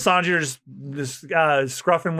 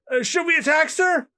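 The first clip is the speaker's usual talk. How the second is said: much higher, nervous question; timid high soldier; 14 semitones higher than usual. Acted. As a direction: ask the boss meekly in a squeak. Timid marine asks Smoker.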